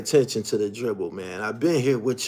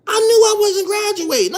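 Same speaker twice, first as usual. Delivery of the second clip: incredulous excitement; 6 dB louder than usual. Real, not acted. Scoffing at four years.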